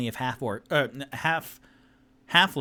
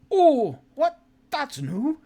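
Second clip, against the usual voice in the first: high surprised squeak; 8 dB louder, slower than usual. Acted. Halfling reacts.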